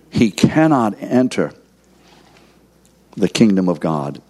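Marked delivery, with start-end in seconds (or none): 0.1-1.5 slow, cannot pressed hard; stress on cannot. Shown as a demonstration. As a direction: lean into cannot, slowly. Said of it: "What's the word again?".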